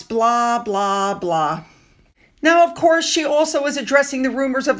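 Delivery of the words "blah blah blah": bored blahs stepping down in pitch; dismissive. Retold or imitated. Commentator waves away talk of sneaker and jam drops, dismissive. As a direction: trail downward on each blah, dismissively. Waving off her product talk.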